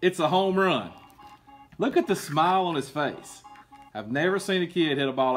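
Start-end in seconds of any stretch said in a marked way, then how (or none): none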